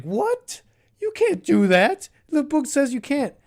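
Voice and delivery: mock scandalized high voice, pitch jumping up; outraged Pharisee. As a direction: play a shocked, stuffy religious scold. Pharisees objecting to Jesus.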